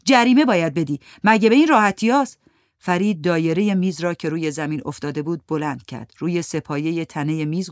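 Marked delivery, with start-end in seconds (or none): 0.0-2.4 louder, angry insistence; angry demand. Acted. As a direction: demand payment, shoving him off. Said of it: "دست فرید را محکم پس زد و گفت". (مطالبهٔ خشمگین و بلندتر جریمه)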